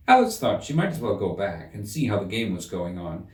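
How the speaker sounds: usual speaking voice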